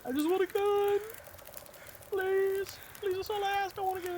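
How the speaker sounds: high, whiny plea for a gun; mock pleading. Streamer begging the game for a gun in a high mock whine.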